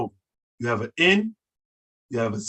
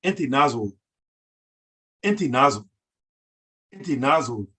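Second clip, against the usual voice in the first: "Inti Nazor" with stress on "Inti" and a falling end; "Inti Nazor" said in its proper rhythm. Shown as a demonstration. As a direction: lead with "Inti", let "Nazor" drop. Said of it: "the proper Afe".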